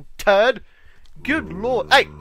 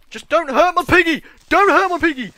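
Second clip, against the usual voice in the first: loud, high frantic plea; frantic pleading; 7 semitones higher, 8 dB louder than usual. Real, not acted. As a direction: shout to protect your pet pig. Pleading with creeper for pig.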